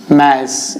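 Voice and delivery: "मास" said as "mass", with a short front a; "मास" with the vowel of "cat"; correct English pronunciation. Shown as a demonstration. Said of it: "इसका pronunciation क्या है?".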